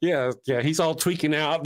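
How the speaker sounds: ordinary delivery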